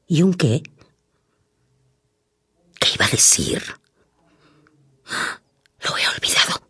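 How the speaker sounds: low unvoiced whisper. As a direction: whisper to yourself. Forgot the line, aside.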